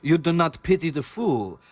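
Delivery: put-on slow, deliberate Russian-accented warning; mock-grave Russian villain line. Blending the catchphrase with the villain, slow Russian accent.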